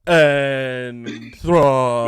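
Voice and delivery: long wailing whale call, loud and drawn out; hammed-up whale-speak, vowels held endlessly; Dory speaking whale. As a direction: stretch every word into a huge swooping moan, starting high and sinking. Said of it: "Ahsoka did her best, uh, Dory impression".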